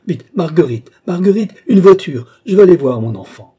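loud, high, frantic summons; urgent calling out. Acted. Mother calls Marguerite for a carriage, urgent shout. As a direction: call out urgently for help at once.

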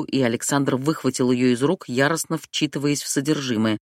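usual speaking voice

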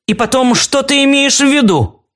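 high-pitched cross demand; angry. A narrator's reading. Alice jumps up to confront Claude, angry. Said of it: "сердито глядя на него".

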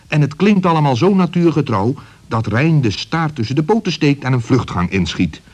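ordinary delivery, nothing marked

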